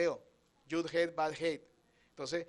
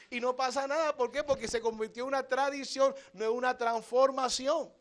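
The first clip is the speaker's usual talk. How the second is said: higher, louder challenging sermon voice; rising preaching; 6 semitones higher, 3 dB louder than usual. Acted. Preacher challenges churchgoers who only celebrate Holy Week, rising and insistent. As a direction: press the congregation, pitch climbing.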